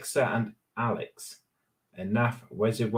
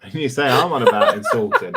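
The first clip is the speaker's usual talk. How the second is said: laughing retort; 2 semitones higher, 7 dB louder, faster than usual. Real, not acted. Teasing retort through laughter.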